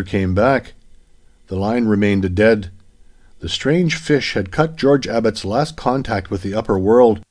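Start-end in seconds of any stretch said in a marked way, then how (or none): none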